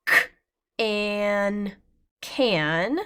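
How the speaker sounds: c-, an, then blended into can; sounding out. Shown as a demonstration. Teacher sounding out can for young readers. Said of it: "teacher sounds out words".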